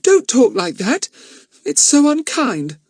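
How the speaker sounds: high, slow shaky reproach. Acted. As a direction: reproach her, voice trembling. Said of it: "in a shaky voice".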